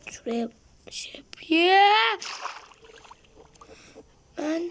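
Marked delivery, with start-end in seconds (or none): 1.5-2.2 high, loud cheer; a loud victory whoop; triumphant yell. Real, not acted. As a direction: shout out the win. Triumphant shout.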